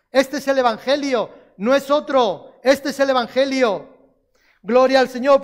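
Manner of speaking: loud, pressing sermon voice; preaching at full force. Acted. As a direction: preach it out over the congregation. (predicación a todo pulmón)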